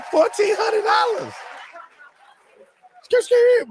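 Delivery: hyped exclamation at the cost; excited squeal. Real, not acted. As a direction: squeal the price in mock outrage. Outraged at the price.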